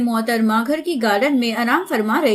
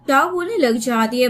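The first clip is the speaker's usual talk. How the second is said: ordinary delivery, nothing marked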